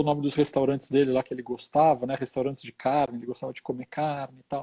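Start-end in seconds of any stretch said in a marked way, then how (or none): none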